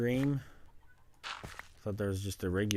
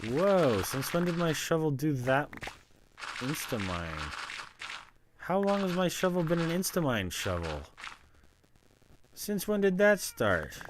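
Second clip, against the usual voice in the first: high, loud surprised outburst; 8 semitones higher, 5 dB louder than usual. Real, not acted. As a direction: react in disbelief at the shovel. Shovel suddenly insta-mines.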